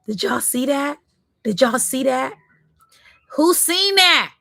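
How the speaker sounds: rising to high, loud astonished cries; can't believe what just happened; shocked calls to the viewers. Real, not acted. A card jumps out, live.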